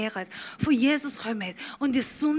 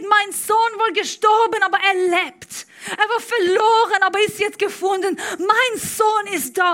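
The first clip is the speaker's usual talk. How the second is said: louder, ringing call of a father who found his son; father's joyful cry; 9 semitones higher, 9 dB louder than usual. Retold or imitated. Father running to his returning son, joyful louder cry. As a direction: cry it out in joy. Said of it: "der Vater rennt zu ihm und schreit".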